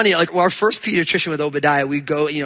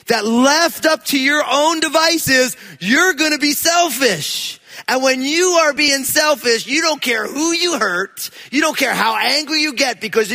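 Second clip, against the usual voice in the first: forceful preaching; 6 semitones higher, slower than usual. Acted. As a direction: drive the warning home to the congregation.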